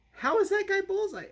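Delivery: very high-pitched mock disbelief; hammed-up incredulous question. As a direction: squeak the question in exaggerated bafflement. Acting out own disbelieving reaction.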